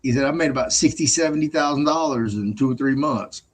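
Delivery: telling the narrator about TikTok earnings, excited and high; friend's voice. Retold or imitated. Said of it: "he goes".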